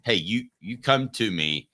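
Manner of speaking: normal talking voice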